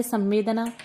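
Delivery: usual speaking voice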